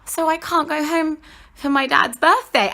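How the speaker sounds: high, tearful retold question; close to tears. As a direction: re-enact being about to burst into tears. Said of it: "I literally burst into tears".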